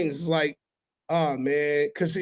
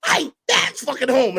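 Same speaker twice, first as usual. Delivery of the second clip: excited dogs; 8 semitones higher, 5 dB louder than usual. Retold or imitated. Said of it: "all the other dogs would be like".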